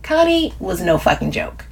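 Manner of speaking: ordinary delivery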